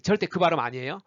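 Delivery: ordinary talk, nothing marked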